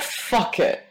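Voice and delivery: usual speaking voice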